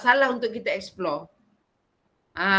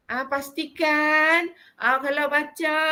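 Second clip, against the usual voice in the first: slow, measured, not fierce; firm but gentle; 5 semitones higher, slower than usual. Shown as a demonstration. Azalia's way of correcting Quran readers, slow and gentle. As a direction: guide the reader calmly but firmly. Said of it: "dia tak cakap macam tu".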